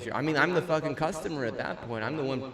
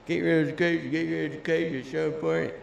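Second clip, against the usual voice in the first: higher, louder sneering parrot of the adults; mocking voice; 5 semitones higher, 5 dB louder than usual. Ranting about school, he mocks the adults' nagging in a higher sneering voice. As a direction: mimic the nagging grown-ups, snidely. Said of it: "like, damn".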